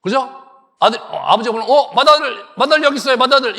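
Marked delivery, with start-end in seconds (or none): 1.7-3.6 loud, higher show-off calling out; boastful eager Esau. Retold or imitated. Preacher voicing Esau to Isaac, a loud boastful call. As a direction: Esau proudly announcing himself to his father, loud and eager.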